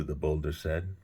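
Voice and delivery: plain reading voice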